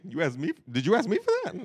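mock-incredulous parent; incredulous questioning. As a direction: act out a parent's disbelieving question. Acting out questioning his son.

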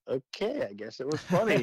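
a light, half-amazed okay, as if caught off guard; pleasantly surprised. Retold or imitated. Surprised at the room's laughter.

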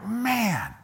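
high exasperated "Man"; fed-up cry of frustration; frustrated groan. Retold or imitated. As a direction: sigh it out, fed up. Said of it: "So he's like".

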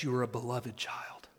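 hushed, tender assurance; tender whisper. Real, not acted. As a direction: whisper it like a blessing. Preacher softens tenderly.

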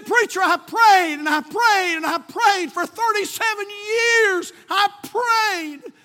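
high, loud, drawn-out testimony; wailing revival shout; imitated church shouting. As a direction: cry it out like an overjoyed old lady. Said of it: "I mean, just shouting".

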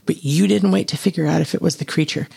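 plain reading voice

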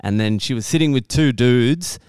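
usual speaking voice

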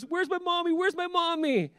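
very high mock child's wail; whiny little boy. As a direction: mimic a little kid whining for mother. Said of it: "That boy always whines and cries".

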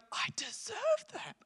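hushed entitled sigh; mock self-pitying whisper. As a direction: breathe it like a sulking child. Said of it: "we're like".

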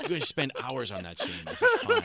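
laughing mock exasperation; high, laughing protest.

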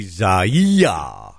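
"Zaya" said slowly as zah-YEE-ah; slow, sounded-out "Zaya"; drawn-out name demonstration. Shown as a demonstration. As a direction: stretch the middle of "Zaya" into a long yee. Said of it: "So that's gotta be".

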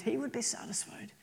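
breathy whispered thought; a private whispered longing. Acted. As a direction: hush it like a secret wish. Preacher voicing Asaph's inner thought.

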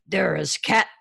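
gruff ogre pointing. Acted. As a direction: speak as a simple ogre.

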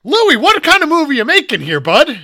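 loud, high-pitched comic rant; hollered, incredulous question; exasperated mock shout. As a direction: call the director out in mock disbelief. Mock confronting the absent director.

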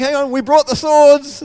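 loud, high eager cry; itching for a fight; eager disciples. Retold or imitated. Disciple calling to Jesus, loud and eager. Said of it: "It's like".

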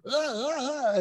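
high, hammed-up cartoon moaning; squeaky cartoon ahs, not sexy at all; cartoon character moan. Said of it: "It sounds like you're a cartoon character".

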